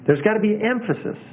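EMPHASIS pitched high, then falling; emphatic. Shown as a demonstration. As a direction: lean firmly on the word emphasis. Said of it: "Just the way I said that".

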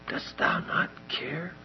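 whispered, slow; whispered accusation. Acted. Echoing Martha to the congregation, whispered slow accusation. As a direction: breathe the question. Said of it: "That's a horrible indictment".

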